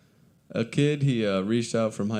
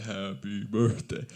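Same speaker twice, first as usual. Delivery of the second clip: slow, stretched whippet voice; slowed down deep; 4 dB quieter, slower than usual. Shown as a demonstration. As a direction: drag it out like slow motion. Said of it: "They make your voice deeper".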